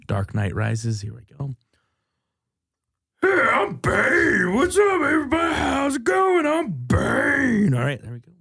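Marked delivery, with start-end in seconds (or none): 3.2-7.7 bad, much higher, louder cartoonish Bane; deliberately terrible villain voice, squeaky and slow; Bane impression. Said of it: "my weekly Bane impression".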